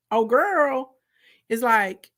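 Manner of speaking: ordinary talk, nothing marked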